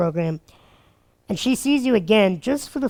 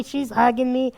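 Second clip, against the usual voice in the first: ordinary talk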